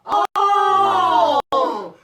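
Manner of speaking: loud, high drawn-out oh; dawning understanding, hammed up; mock realization. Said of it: "I was like".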